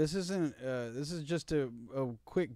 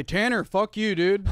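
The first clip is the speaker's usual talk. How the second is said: loud, raised mock Midwest accent; 6 semitones higher, 9 dB louder than usual. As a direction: shout it like a riled Midwesterner. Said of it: "what do people in the Midwest say".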